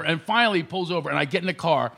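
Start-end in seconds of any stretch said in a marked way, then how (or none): none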